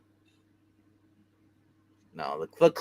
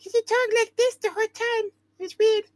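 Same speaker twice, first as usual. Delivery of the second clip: much higher imitated voice; squeaky high impression of a friend; Amy's voice. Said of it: "did Amy's voice tone".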